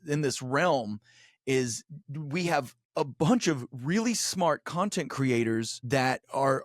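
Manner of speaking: ordinary delivery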